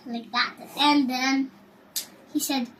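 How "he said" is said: ordinary delivery, nothing marked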